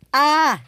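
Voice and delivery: short, high acted shriek; startled scream. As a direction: cry out in sudden alarm. Engine cries out.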